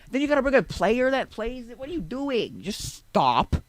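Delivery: high, loud exasperated scolding. Retold or imitated. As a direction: scold them, landing hard on the last word. Arguing back at friend's idea.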